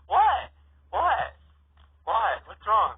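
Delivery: high, loud, startled questions; puzzled siblings react loudly to the pun; shocked what. Acted. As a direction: jump at it, baffled.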